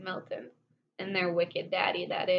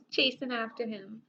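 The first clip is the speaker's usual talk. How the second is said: plain reading voice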